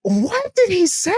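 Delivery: high, drawn-out squeal of a question; mock incredulous. Storyteller replaying their reaction to the date, mock incredulous. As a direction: stretch it out way up high.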